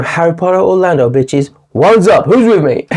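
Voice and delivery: rally the troops, hammed up; mock-heroic battle cry. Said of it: "I'm going".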